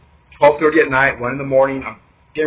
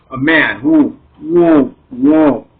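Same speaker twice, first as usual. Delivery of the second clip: mock startled whoas; 4 dB louder, much slower than usual. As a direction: act out being taken aback. Acting out startled reaction to shadow.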